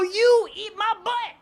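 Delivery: high, slow mock-outraged comeback; indignant retort. Comedian answering the girl's disgust, high slow indignant retort. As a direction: throw it back at her, drawn out. His retort in the retold quarrel.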